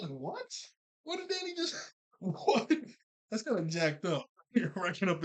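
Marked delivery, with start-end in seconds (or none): 0.1-2.7 mock baffled. Tucker baffled by Sam.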